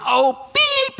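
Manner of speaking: squeaky high mock curse; yelping bleeped-out curse. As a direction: blurt a cartoonish fake curse. Mimics his old cursing habit.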